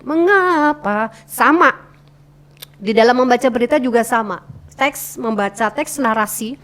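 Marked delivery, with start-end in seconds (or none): 0.0-1.1 "Mengapa" stretched high, then falling; rise-fall intonation. Shown as a demonstration. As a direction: arch the tune over a slow "Mengapa". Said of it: "naik turunnya". ("Mengapa" panjang, naik lalu turun)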